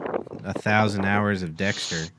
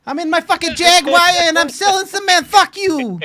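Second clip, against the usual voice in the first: much higher, louder Italian mob boss yell; hammed-up Don Paisan ranting from his Jaguar; Don Paisan impression; 7 dB louder than usual. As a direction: blast it like a brash Italian cement boss. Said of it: "That's your impression of Don Paisan?".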